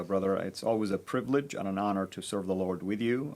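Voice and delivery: ordinary talk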